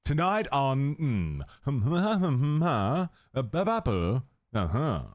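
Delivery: mock newsreader intonation, swooping up and down; exaggerated sing-song news voice. Shown as a demonstration. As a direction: parody a news anchor's cadence, humming the words. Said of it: "where the news voice started".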